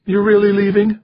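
very high wife's voice with a touch of fear; small, almost frightened. A narrator's reading. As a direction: ask it anxiously, way up high. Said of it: "her voice going small, almost frightened".

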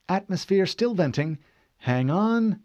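high, focused voice; busy ship's AI. Acted. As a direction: report while concentrating on the task. Cortana concentrates on righting ship.

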